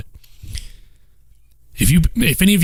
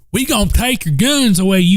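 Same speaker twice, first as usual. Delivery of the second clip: higher mock voice of a gun-grabber; mocking gun-grabber quote; 7 semitones higher than usual. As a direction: sneer out the words you're accused of saying. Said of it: "not the words".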